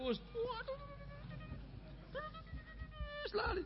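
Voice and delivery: put-on very high, soft, whimpering child voice; absorbed child whine. Child glued to gamebot, squeaky high and soft whimper. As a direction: squeak like a kid lost in his game.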